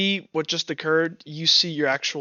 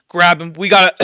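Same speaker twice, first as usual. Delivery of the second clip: loud, urgent call over the fire; shouting orders through smoke; 8 dB louder than usual. Acted. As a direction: bark it so the others move now. Vex orders escape from fire.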